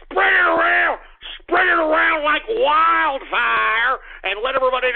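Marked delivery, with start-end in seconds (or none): 0.1-1.0 pushed up high and loud, urging; loud emphatic repeat. Real, not acted. Host urging listeners to share the show, loud and high. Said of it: "repeats plea louder". 1.4-4.0 drawn out slowly, each word leaned on; slow stretched plea. Real, not acted. Host begging listeners to spread the show, slow and emphatic. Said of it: "repeats plea slower".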